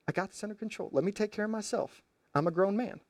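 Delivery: cocky man waving off advice; dismissive brush-off. Retold or imitated. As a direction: wave off the worry lazily. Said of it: "Samson seems to say".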